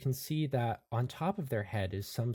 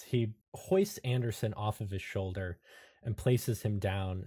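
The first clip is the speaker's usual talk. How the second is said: usual speaking voice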